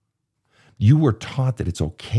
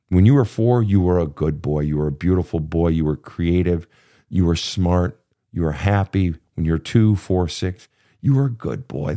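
ordinary talk

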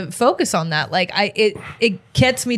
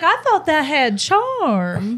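mock hurt; 7 semitones higher, much slower than usual. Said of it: "I was like".

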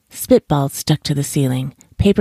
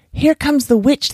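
ordinary delivery, nothing marked

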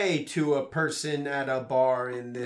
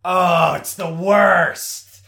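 loud, drawn-out groaning agreement; emphatic exasperation; 4 semitones higher, 11 dB louder, much slower than usual. Real, not acted. Listener commiserating over store worries, loud exasperation. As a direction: stretch it out loudly, higher, commiserating.

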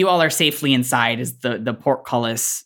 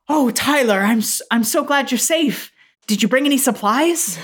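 high, gushing mother voice; relieved mother; 11 semitones higher than usual. Acted. Mother greets her returning kid Tyler, relieved. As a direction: fuss over your kid in a high voice. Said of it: "this woman comes running up and she says".

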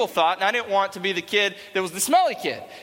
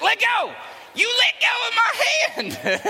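high strained mock-angry shout, drawn out at the end; mad mom; slower than usual. Mom struggles to free her hand, hammed-up high angry yell. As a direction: yell it like a furious little mom. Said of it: "she would get so mad".

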